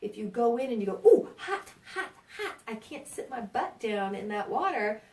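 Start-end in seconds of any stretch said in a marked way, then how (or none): 1.0-5.0 mock yelp at scalding water; too hot yelp. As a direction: pretend bath-goer jumping out of hot water. Patient voiced in too-hot bath.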